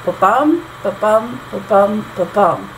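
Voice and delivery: four separated ba-BUMs, stepping down to the last; iambic tetrameter beat. Shown as a demonstration. Teacher counting out four feet, iambic beat. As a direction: beat out ba-bum with a pause after each BUM. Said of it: "I had four feet".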